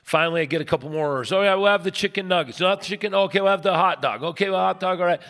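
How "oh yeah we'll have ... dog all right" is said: quick-fire, raised-pitch order taking; rapid mock-auctioneer patter. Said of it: "So like an auctioneer".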